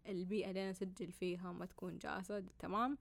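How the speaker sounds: ordinary talk, nothing marked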